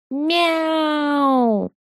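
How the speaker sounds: high yowling meow right in the ear; cat yowl. A narrator's reading. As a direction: screech it like a cat into his ear. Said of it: "орал ему прямо в ухо". (истошное кошачье «мяу»)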